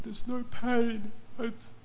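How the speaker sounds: high, drawn-out tearful amazement; imitating tearful crying. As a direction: retell it as a man crying with relief. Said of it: "he's just crying and crying".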